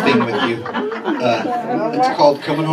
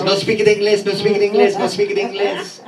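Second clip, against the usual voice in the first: mock broken English; 7 semitones higher than usual. Comic griping about customers who no speak it English. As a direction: parrot the broken English in a higher mock accent. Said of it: "all day long I says".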